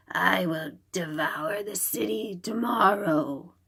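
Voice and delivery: whispered, sore-throated threat; hoarse whisper. Acted. Nian threatening the city in a sore whisper.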